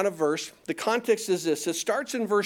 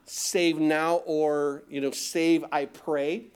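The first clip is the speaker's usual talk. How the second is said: ordinary talk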